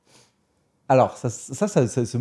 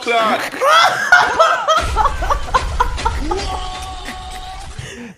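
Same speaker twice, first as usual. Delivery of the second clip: shrieking delighted laugh, very high; loud burst of laughter; 5 dB louder than usual. Real, not acted. Host cracking up at her punchline, shrieking laugh. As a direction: explode laughing. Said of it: "tu me sors des punchlines".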